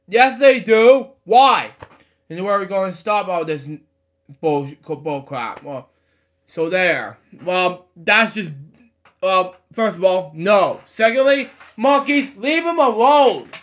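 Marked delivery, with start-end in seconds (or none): 0.1-1.7 loud, raised, drawn-out contradiction; indignant retort. Real, not acted. Argues back at the monkeys. 10.8-13.5 loud, high-pitched telling-off; exasperated scolding. Real, not acted. Scolds the monkeys in the story.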